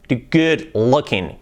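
good said slowly with a short oo, gʊd; slow model. Shown as a demonstration. Teacher models good looking slowly for students. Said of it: "Que no sea good".

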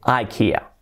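ikea said "eye-KEE-uh"; English "ai-KI-a" for ikea; English pronunciation. Shown as a demonstration. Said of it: "Nada de ikea".

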